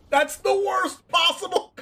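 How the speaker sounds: high, loud cry of dismay; laughing outrage. Real, not acted. Streamer hit by the worst possible outcome, outraged. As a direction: wail it loudly, about to crack up.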